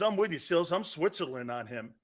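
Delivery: lightly voiced Tone, dropped to a murmur on I'm Switzerland on him; a hint of Tone, low and hushed; imitating Tone. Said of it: "Tone says".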